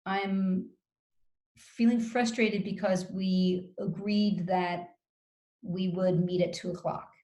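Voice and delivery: slow and calm. Shown as a demonstration. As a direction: coach demonstrating to Sarah how to speak slowly and calmly. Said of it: "I speak to you very slowly without that sarcasm".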